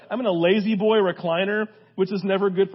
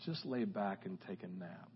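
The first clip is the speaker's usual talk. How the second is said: very soft, gentle, lower voice; God's gentle voice; 12 semitones lower, 18 dB quieter than usual. Retold or imitated. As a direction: soothe him like God inviting rest. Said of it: "I just felt like God say".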